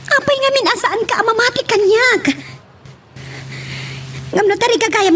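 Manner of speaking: pitched high in disbelief, demanding why; exasperated outburst. Acted. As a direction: throw the question back at her, incredulous and high. Argument with Wendy over Patrick.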